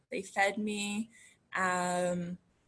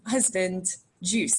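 normal talking voice

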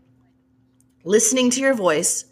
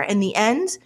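ordinary delivery

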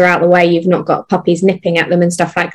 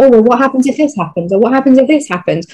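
quick, higher-pitched what-ifs; higher, hurried mock worry; mock fretting; 5 semitones higher, faster than usual. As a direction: imitate an overthinker running through worries. Mimics an overthinker's what-if worries.

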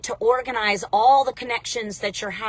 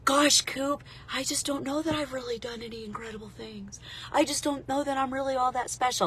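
much softer, murmured self-doubting voice; mock self-doubt; 9 dB quieter than usual. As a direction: voice a viewer's inner doubt, quietly. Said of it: "you're telling yourself in your mind".

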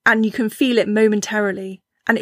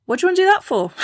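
mocking doubter; 9 semitones higher, much faster than usual. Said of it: "and someone else goes".

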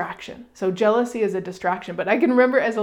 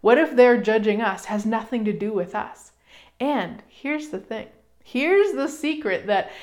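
ordinary talk

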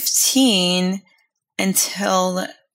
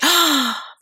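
sharp gasp; 7 semitones higher than usual. Retold or imitated. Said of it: "it was instantly like".

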